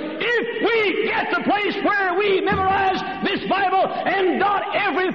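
very high, shouted pulpit cry; full-force preaching. Real, not acted. Preacher challenging fundamentalists to weep, impassioned shouting.

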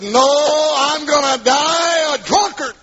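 high, drawn-out defiant holler; defiant shout. Retold or imitated. Drinker refusing his pleading wife, defiant shout. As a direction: shout it out slowly, re-enacting a stubborn refusal.

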